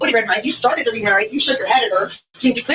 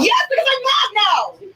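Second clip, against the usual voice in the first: raised angry voice, slow and drawn out; angry yelling; 4 semitones higher, much slower than usual. Real, not acted. Arrestee in the squad car yelling back at the officer, angry. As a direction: yell it back at the officer, slowly. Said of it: "You're still yelling right now".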